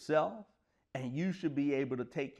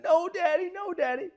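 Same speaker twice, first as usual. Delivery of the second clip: much higher, loud tearful protest; crying child; 4 dB louder than usual. Retold or imitated. Scared daughter refusing the daycare, crying to her dad. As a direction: plead through sobs like a frightened little girl. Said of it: "bursts into tears and starts screaming".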